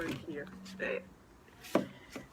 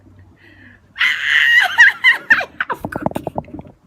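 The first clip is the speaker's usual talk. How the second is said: high, loud squealing laugh; real delighted shriek; 17 dB louder than usual. Real, not acted. Shrieks finding the card.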